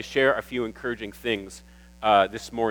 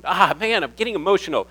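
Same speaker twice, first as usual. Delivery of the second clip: getting emotional; 7 semitones higher, 3 dB louder, faster than usual. Retold or imitated. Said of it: "I told your mom on the phone".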